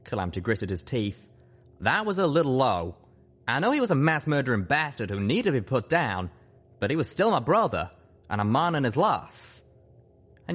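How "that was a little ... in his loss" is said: Calamity's voice. Acted. Calamity hurt.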